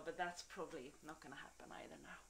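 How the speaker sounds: soft, breathy whisper. Real, not acted. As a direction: let it drop to a whisper. Disappointed aside about cancelled dance.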